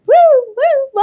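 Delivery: very high, loud siren woo; alarm siren imitation. Teacher imitating her fire alarm going off, very high and loud. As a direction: wail it like a shrieking alarm.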